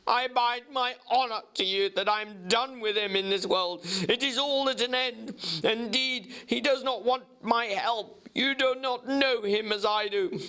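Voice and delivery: high, urgent pleading voice; feverish doctor. Acted. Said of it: "his friend's feverish manner".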